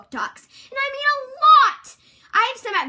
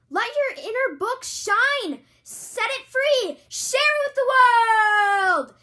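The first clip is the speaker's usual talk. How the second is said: slow dramatic urging; 7 semitones higher, slower than usual. Acted. Commercial, urging dramatic delivery.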